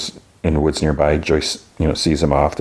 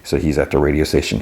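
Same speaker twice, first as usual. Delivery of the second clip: ordinary delivery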